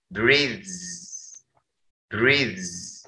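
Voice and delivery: breathes said slowly and softly, final s as z; drilling breathes for students; repeated demo of breathes. Shown as a demonstration. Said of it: "dibacanya breathes".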